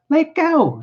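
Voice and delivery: very high-pitched woman's cry. Acted. Wife cries as man grips her hair, high panicked cry. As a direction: cry out to be released, pitch shooting up. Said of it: "she cried".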